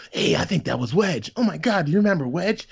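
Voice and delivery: high, eager elbow-nudge whisper-shout; hammed-up excited moviegoer; mock fan excitement. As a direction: tease a fan spotting a cameo. Fan nudging friend in cinema.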